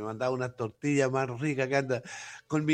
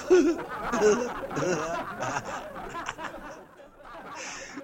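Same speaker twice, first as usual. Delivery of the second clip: long high-pitched laugh; 12 semitones higher than usual. Real, not acted. Laughs at his own remark.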